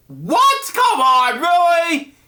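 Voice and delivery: jumps way up high and loud, disbelieving; can't believe what just happened in the story; incredulous outburst. Real, not acted. Reader protesting the story's twist, high incredulous outburst.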